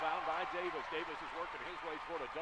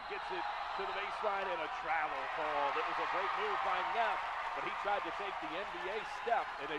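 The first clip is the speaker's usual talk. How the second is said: louder, rising play call as the action speeds up; excited call; 3 semitones higher, 8 dB louder than usual. Real, not acted. Announcer calling a steal and travel on the radio, excited. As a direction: punch out the travel call with energy.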